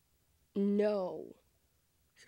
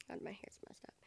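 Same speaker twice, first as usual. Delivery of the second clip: whispering; 17 dB quieter, faster than usual. Real, not acted. Aside about own hair.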